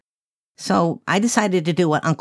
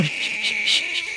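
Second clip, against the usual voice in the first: hushing; 6 dB quieter, faster than usual. Acted. Hushing a crying baby.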